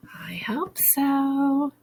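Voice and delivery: slow, high, soft whisper; whispered aside. Acted. Teacher whispers a hopeful aside to the children. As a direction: breathe it out like a little secret.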